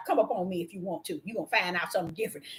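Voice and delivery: low, quiet, fast dog threat; soft, low and fast threat from the dog Ice; menacing dog. Retold or imitated. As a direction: growl it low and quick. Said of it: "And Ice said".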